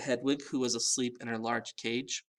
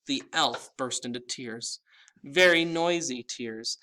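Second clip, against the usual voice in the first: usual speaking voice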